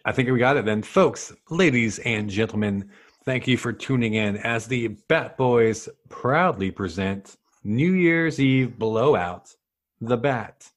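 normal talking voice

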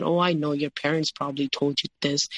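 normal talking voice

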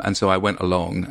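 normal talking voice

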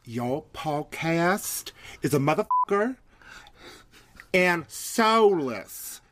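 slow, drawn-out put-on Southern accent; shaky attempt at a Southern drawl. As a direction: stretch it out in a hammy Southern twang. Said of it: "I'm, I'm Southern, y'all".

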